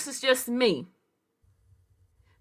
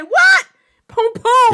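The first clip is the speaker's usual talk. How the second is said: put-on high excited Jamaican accent; hammed-up Jamaican exclamation; mock Jamaican; 10 semitones higher, 5 dB louder, slower than usual. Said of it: "that ain't Jamaican sounding".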